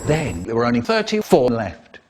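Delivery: normal talking voice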